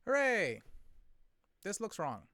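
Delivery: ordinary talk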